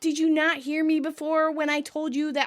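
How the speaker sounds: whining, impatient nagging; Joey. Acted. Joey hurries Rachel along with dessert, whining. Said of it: "Joey continues whining to Rachel".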